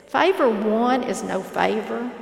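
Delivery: plain reading voice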